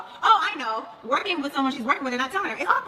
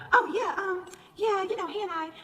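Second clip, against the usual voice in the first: imitated evasive hesitation; 7 semitones higher, slower than usual. Imitates Drew's evasive, hesitant answer.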